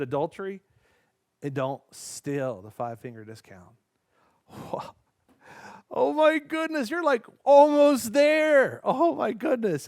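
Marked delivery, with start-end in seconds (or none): 6.0-9.9 much higher and louder, slow amazement; excited. Real, not acted. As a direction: gasp with delight at their progress. Kids nearly finishing commandments.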